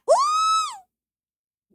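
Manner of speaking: very high, sustained re-enacted squeal; excited squeal. Woman acting out her reaction to his yes, excited squeal. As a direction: let out one long piercing "ooh".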